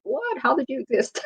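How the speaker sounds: high, soft incredulous "what?"; mock disbelief. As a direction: imitate a baffled young person. Voices an incredulous young person.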